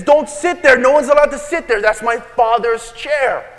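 high, loud warning that eases off; a kid defending his dad's chair; insistent child. Retold or imitated. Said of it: "And he says".

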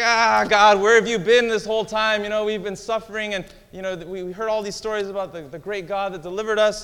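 loud, high-pitched griping at God; hammed-up aggrieved complaint; complaining. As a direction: protest loudly, like a long-suffering victim. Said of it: "He starts to complain".

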